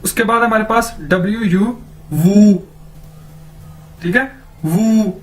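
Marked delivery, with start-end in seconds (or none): 2.1-5.1 वू rounded and drawn out; Chinese wu. Shown as a demonstration. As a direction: say वू as the Chinese five. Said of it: "इसको ऐसे pronounce करेंगे".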